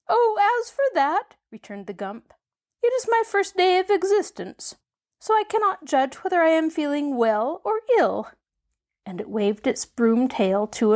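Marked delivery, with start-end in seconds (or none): 0.1-1.3 high squeaky creature voice; tiny squeak from a huge beast; squeaky gump voice. A narrator's reading. Newly awakened gump marvelling at itself, squeaky voice. 2.8-8.3 high squeaky creature voice; tiny squeak from a huge beast; squeaky gump voice. A narrator's reading. Newly awakened gump marvelling at itself, squeaky voice.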